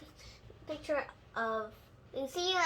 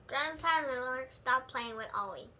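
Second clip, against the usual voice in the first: ordinary delivery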